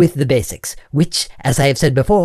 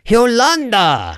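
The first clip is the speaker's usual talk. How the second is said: Yolanda higher and drawn out; exasperated correction; 6 semitones higher, slower than usual. Acted. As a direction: insist on the name, losing patience. Teacher exasperated at pupil's wrong guess.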